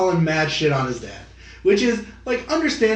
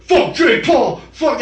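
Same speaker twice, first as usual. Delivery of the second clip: higher, louder hammed-up yell; mock shout; 7 semitones higher, 4 dB louder, slower than usual. Mocking [name]'s promo on stage, hammed-up yelling. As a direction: bellow it like a crowd-baiting heel. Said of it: "He comes out there like".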